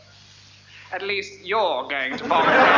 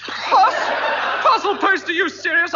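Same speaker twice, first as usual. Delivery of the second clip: much higher squeal of disbelief on parcel; a shocked yelp at the idea; outraged disbelief; 7 semitones higher than usual. Acted. Passenger appalled by the conductor's suggestion, shrieking.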